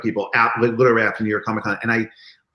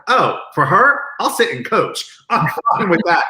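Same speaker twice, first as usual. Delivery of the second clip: gleeful eager yes; 7 semitones higher, 3 dB louder than usual. Retold or imitated. Agreeing to give up his seat.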